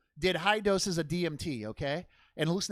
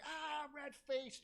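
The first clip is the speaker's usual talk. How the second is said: slow, higher strangled groan; mock heart attack gasp; 10 semitones higher, 17 dB quieter, much slower than usual. Telling how the bully's dad seized up, strangled groan. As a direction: play a man choking on his own rage. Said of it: "Bob's just like".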